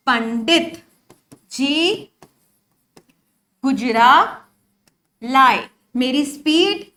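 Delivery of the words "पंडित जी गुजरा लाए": word by word, long pauses between; slow, deliberate. Real, not acted. Teacher slowly recalling the mnemonic with students, pausing after each word. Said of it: "slow हो सकती है".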